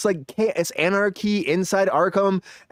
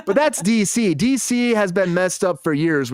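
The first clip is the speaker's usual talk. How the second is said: ordinary talk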